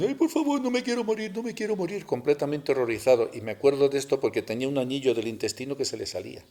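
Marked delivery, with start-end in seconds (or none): 0.0-2.1 high, desperate whimpering cry of a dying man; terrified moaning plea. Retold or imitated. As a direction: beg for your life in a high moaning wail, imitating the injured driver. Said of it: "gemía como un ... completamente horrorizado".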